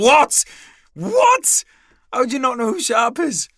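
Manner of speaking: very high-pitched squawk of disbelief; hammed-up outrage, voice shooting up; incredulous. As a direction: shriek what, then demand an explanation. Retelling disbelief at the lad.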